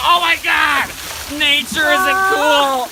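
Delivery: high, loud, slow yelling; panicked shouting. Acted. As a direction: scream while running away. Fleeing the bear in panic.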